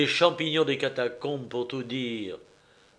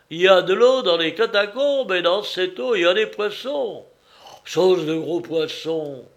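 loud, higher, exultant delivery; immense satisfaction; 5 semitones higher, 5 dB louder than usual. A narrator's reading. Starving man learns of fish in the catacombs, immensely satisfied. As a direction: revel eagerly in the good news. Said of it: "avec une immense satisfaction".

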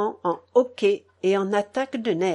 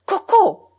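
high, loud exclamation; piercingly high call, falling away; crying out; 11 semitones higher, 9 dB louder than usual. A narrator's reading. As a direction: cry the name out sharply. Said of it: "s'écria grand-mère".

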